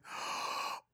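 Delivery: sharp imitated shocked intake of breath; mock gasp. Retelling the Spanish girl's shock, imitated gasp. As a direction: gasp as if scandalized. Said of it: "And then she says".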